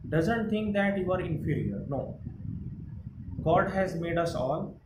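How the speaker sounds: ordinary delivery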